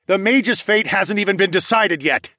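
loud, high objection; heated arguing. A narrator's reading. Hero toe-to-toe with the bear, arguing. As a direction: argue back at full voice. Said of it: "I argued".

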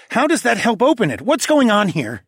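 high, loud, urgent questions; demanding. A narrator's reading. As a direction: press hard for an explanation. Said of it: "Tawa demanded".

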